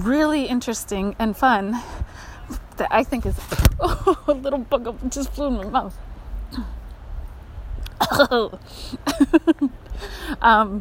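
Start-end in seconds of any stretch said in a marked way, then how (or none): 6.0-10.3 startled laugh. Real, not acted. Bug flew in mouth.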